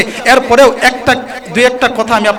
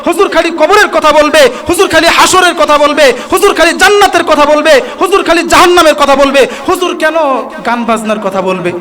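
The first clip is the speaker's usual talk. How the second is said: higher, louder mock whine of the critics; 6 semitones higher, 4 dB louder than usual. Preacher mimics critics to the crowd, higher mocking whine. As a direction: parody the complainers who scold preachers. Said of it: "সমালোচনা শুরু করে দেয়".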